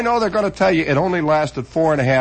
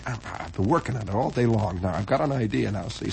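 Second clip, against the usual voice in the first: softer, lower psyching up backstage; muttered to himself; 7 semitones lower, 7 dB quieter than usual. Retold or imitated. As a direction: talk yourself up under your breath. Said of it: "you say".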